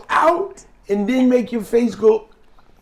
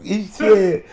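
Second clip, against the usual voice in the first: ordinary talk, nothing marked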